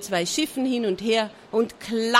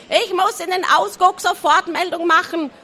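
high, excited clucking voice; cackling hen; 8 semitones higher, 5 dB louder than usual. A narrator's reading. The hen cackling eagerly to the pirates. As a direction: squawk it like a fussy hen.

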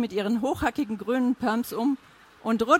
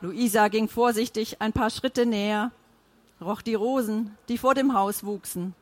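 plain reading voice

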